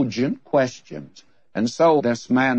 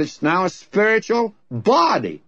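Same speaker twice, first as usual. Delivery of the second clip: raised pitch, landing hard on "body"; stress on BODY; 7 semitones higher than usual. Retold or imitated. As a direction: punch the word "body" after "spiritual". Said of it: "the emphasis is on body".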